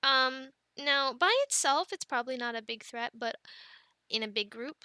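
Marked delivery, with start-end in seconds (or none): none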